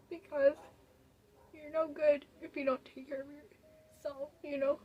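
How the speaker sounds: crying it out, voice breaking; real crying. Real, not acted. Telling viewers self-care matters, sobbing. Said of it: "I don't know why I'm getting so emotional".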